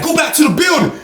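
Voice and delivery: high barked order; mock guard command; imitating guards. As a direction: order them back like a guard. Guards ordering inmates back.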